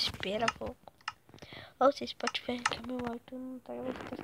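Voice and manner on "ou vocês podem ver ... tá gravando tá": whispering. Real, not acted. Whispering while recording game.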